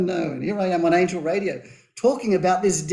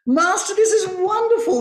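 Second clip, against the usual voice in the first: high, delighted farmer voice; young farmer marveling, much higher; 13 semitones higher, 4 dB louder than usual. A narrator's reading. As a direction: lift into the eager young farmer.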